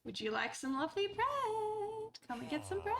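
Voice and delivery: high, creepy sing-song offer; horrible luring voice. Acted. Offering bread to lure a villager, creepy high coax. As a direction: tempt them like a shady stranger with sweets. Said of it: "I don't know why I sound like that".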